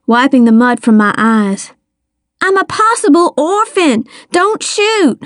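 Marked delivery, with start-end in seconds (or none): 2.4-5.2 high, urgent pleading; panicked plea. A narrator's reading. Child pleading with the armed detective, high and panicked. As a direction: plead frantically at a high pitch.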